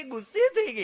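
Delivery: high, loud, alarmed report; alarmed city guest. Retold or imitated. A scared guest telling the host the dog got angry. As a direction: blurt it out in a fright.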